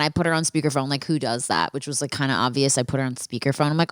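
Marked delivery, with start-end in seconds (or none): none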